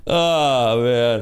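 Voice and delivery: ordinary delivery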